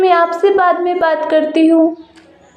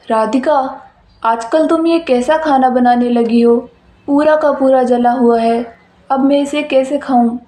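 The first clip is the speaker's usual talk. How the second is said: lowered male voice, mildly angry; scolding husband; 4 semitones lower than usual. Acted. Husband scolds wife over burnt dinner, lowered male voice. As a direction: grumble about the burnt food, voiced low.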